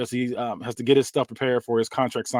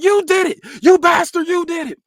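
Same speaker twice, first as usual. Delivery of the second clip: much higher, much louder accusing shout; furious yell; 8 dB louder than usual. Acted. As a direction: scream the blame at him. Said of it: "Maven yells".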